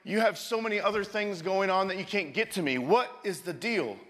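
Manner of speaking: high, loud complaint to God; mad at God. Retold or imitated. Friend complaining to God, high and loud. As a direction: push the frustration, voice climbing. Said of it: "But he's mad".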